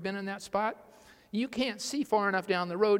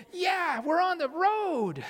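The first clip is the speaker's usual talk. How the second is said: cheerful; 8 semitones higher, slower than usual. Retold or imitated. Travellers cheerfully joining along.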